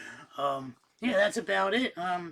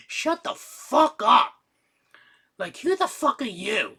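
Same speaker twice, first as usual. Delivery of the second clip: retold outburst; 7 semitones higher, 7 dB louder than usual. Snapping back at an in-game voice.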